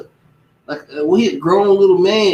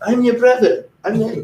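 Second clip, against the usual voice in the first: higher, mock-friendly I'm your brother; mock friendly shopkeeper; 7 semitones higher than usual. Mocking a shopkeeper who acts like a brother, pitched up. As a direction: put on a chummy act, pitched up. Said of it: "some be wearing do-rags".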